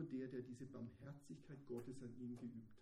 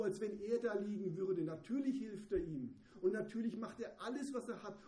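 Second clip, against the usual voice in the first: loud, high, urgent preaching; fervent sermon climax; impassioned preaching; 4 semitones higher, 8 dB louder than usual. Real, not acted. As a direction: drive the point home with fervor. Preaching at full force.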